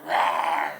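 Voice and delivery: low mock dinosaur rawr; dino roar. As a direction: growl it deep like a T-Rex dad. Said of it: "And I'm like".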